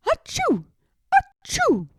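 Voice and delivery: two high, loud sneezes. A narrator's reading. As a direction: sneeze it big. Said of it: "They both sneezed".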